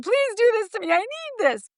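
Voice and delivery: high mock plea; pleading wife. Wife's pleading videos to husband, voiced high. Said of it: "sent videos".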